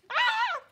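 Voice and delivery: loud high panicked scream. Acted. Family flees the attack, loud panicked scream. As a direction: scream while running away.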